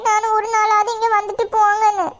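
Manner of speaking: ordinary talk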